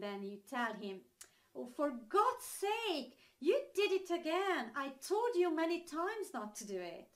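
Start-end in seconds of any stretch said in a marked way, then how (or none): 1.5-7.1 mock scolding of a child, higher pitch; exasperated parent's telling-off, acted out; annoyed. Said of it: "used when you have some annoyance".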